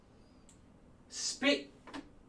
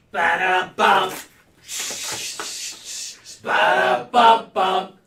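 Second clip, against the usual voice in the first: slow sung drum sting; sung sound effect; 3 semitones higher, 6 dB louder, much slower than usual. Acted. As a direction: hum a dramatic sting slowly. Mimicking a musical sting.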